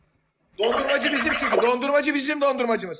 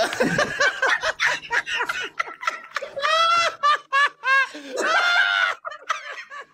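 howling laughter; 14 semitones higher than usual. Real, not acted. Hysterical laughter at chat joke.

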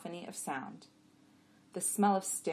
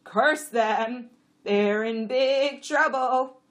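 loud, raised, slow donkey voice; alarmed donkey; 7 semitones higher, 13 dB louder, much slower than usual. A narrator's reading. Jack reacting to news of a curse, loud. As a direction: exclaim loudly, drawing out the end. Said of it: "Abbott said".

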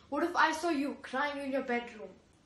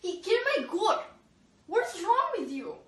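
angry outburst; 7 dB quieter than usual. Acted. Goat owner yells at the goat killer, furious. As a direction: shout the outrage at him. Woman yelling about her goat.

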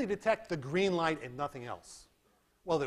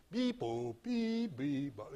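scanner beeps; 3 dB quieter, much slower than usual. Retold or imitated. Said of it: "So it ignores this".

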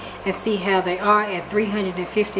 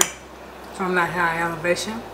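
talking with a mouthful; chewing while speaking; mouth full; 3 dB quieter than usual. Real, not acted. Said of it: "Talking with my mouth full".

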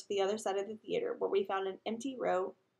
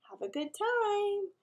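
sing-song sendoff; 12 semitones higher, slower than usual. A narrator's reading. Sendoff, drawn out and high.

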